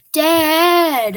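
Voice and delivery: loud whining call of Dad; shouting across the house. Retold or imitated. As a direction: yell for dad, exasperated. Calling out to dad.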